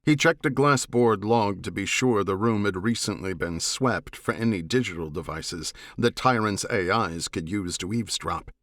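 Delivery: usual speaking voice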